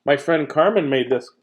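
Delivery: ordinary delivery, nothing marked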